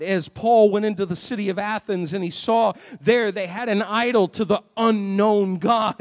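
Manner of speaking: ordinary delivery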